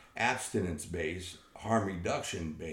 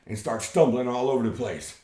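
ordinary talk

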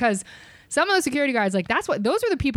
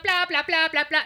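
mock loud ranting; 8 semitones higher than usual. Server imitating the customer's rising complaint, mock ranting. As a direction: mimic a ranter with pitch raised, not volume. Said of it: "getting super loud".

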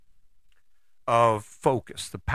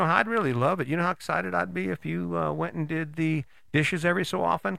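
higher, sugary and coaxing; sweet smiling voice; 5 semitones higher than usual. Acted. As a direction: sweet-talk him into helping, all smiles. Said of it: "you're smiling at him".

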